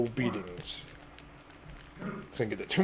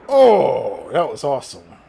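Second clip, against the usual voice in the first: excited; 2 semitones higher, 12 dB louder, slower than usual. Real, not acted. Reacting to big move.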